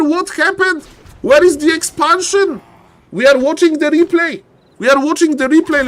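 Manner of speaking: high, loud caster outburst; shocked excitement. Real, not acted. Caster reacting to the double supper, shocked. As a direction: cry it out in high-pitched astonishment. Caster stunned by a replay surprise.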